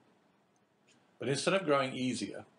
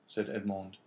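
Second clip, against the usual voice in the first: usual speaking voice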